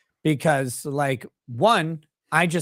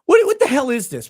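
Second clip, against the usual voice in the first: high, loud startled question; surprised; 11 semitones higher, 8 dB louder than usual. Real, not acted. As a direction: blurt out in surprise. Surprised question about tea.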